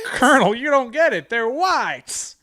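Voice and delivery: high, loud exasperated officer; officer correcting the colonel, high and loud; mock officer. Acted colonel reply scene.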